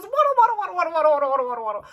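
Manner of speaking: playful singsong. Retold or imitated. Imitating duckling walk.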